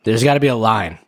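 ordinary delivery

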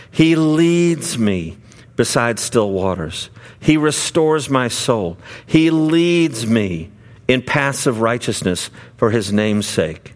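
slow, measured reading of the psalm with long pauses; scripture recitation. Acted. Preacher reciting Psalm twenty-three to the congregation, slow and solemn. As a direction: let each line of the psalm land slowly.

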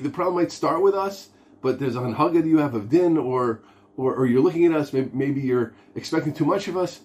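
high-pitched, earnest plea; pleading with God. Acted. Congregant turning to God after messing up, pleading. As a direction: appeal upward, pitch climbing as you concede the point. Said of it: "turning to Ribbono Shel Olam and saying".

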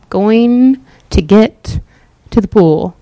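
dragged out, very slow. Shown as a demonstration. Showing the invalid creeping to the pool, very slow. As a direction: stretch each word like inching forward. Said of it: "You see how slow I'm moving?".

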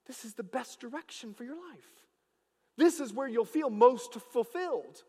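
loud, rising preacher's proclamation; full-force preaching. Acted. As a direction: preach it out to the whole church. Preaching to the congregation.

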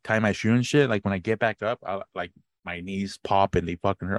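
ordinary talk, nothing marked

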